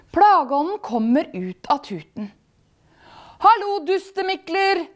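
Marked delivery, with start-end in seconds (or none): 3.4-4.8 high, loud cheeky spirit; calling out. A narrator's reading. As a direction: holler a rude greeting. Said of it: "roper han".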